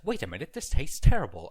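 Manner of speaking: loud realization, disgusted; a mock outburst of disgust; sudden disgust. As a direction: blurt it out in shock. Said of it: "I realized".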